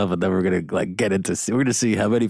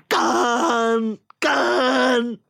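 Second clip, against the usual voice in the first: high, long theatrical yell; Captain Kirk impression. Captain crying out at his nemesis, hammy drawn-out Kirk yell. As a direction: wail the name, stretched to the limit. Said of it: "Shatner refused to let them do an echo".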